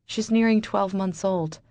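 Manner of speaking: plain reading voice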